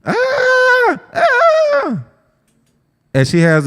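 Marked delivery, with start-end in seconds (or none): 0.0-2.0 high piercing mock baby wail; very high imitated infant scream. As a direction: shriek like a baby crying for its mother. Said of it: "that baby gonna start screaming for mama".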